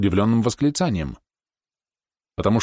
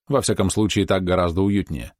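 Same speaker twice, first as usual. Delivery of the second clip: ordinary delivery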